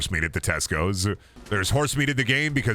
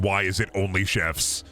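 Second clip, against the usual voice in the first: ordinary delivery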